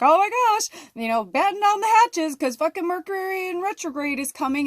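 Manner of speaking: high, loud mock-panicked alarm; Chicken Little. Warning everyone about Mercury in retrograde, hammed-up panic. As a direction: cry out like the sky is falling. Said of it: "you know, the Chicken Little".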